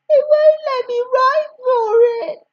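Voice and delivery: hiccupy crying. A narrator's reading. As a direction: gasp it out through hiccups. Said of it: "I gasp in a hiccupy fashion".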